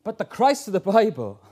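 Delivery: normal talking voice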